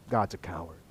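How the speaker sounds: soft, low, dropped-in aside; low dismissive verdict. Acted. Job winning by forfeit, low quiet dismissal of God. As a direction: drop right down to deliver the judgement.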